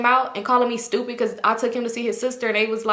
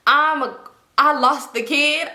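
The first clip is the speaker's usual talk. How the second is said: higher, slow, retold distress; stressed; 5 semitones higher, 3 dB louder, slower than usual. Aunt realizing she lost the child, retold stress. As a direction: stretch it out in raised-pitch dismay. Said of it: "I'm stressed".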